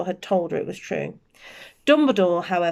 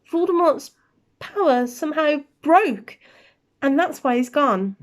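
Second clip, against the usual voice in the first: higher, a touch of trembling; 7 semitones higher than usual. Acted. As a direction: hold back tears, lightly voiced. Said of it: "McGonagall's voice trembled".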